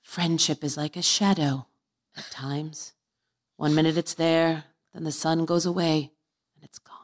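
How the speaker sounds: slow verse reading, fading away; dramatic poem reading. Acted. Reading a teenage poem to the hosts, slow poem recital. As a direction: recite it slowly, dying off to a hush.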